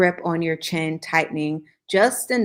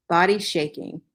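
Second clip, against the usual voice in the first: ordinary delivery, nothing marked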